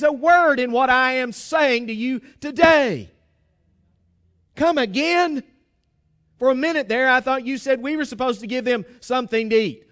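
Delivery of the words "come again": disbelieving. Acted. Committee disbelief repeated.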